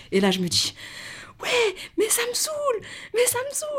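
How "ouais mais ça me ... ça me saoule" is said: high-pitched whiny sobbing, imitated; exaggerated tearful wail, far above normal pitch; mock crying. Daughter complaining tearfully to her father, mock crying. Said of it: "je commence à pleurer".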